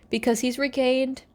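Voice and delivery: ordinary talk, nothing marked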